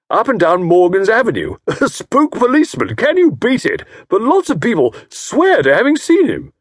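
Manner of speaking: loud, high, jovial teasing; hearty old fellow. A narrator's reading. Jovial host teasing Tommy about his wife, hearty. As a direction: slap him on the back with it. Estcourt tells ghost tale.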